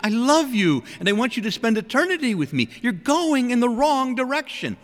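high, urgent imitated plea from God; impassioned pleading, pitched well up; crying out. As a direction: call out to someone heading astray. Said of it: "He's crying out, he says".

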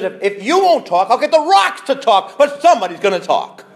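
high, loud, rising warning; booming voice of Jesus; ringing declaration. Retold or imitated. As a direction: thunder it out as a prophet. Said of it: "Jesus says".

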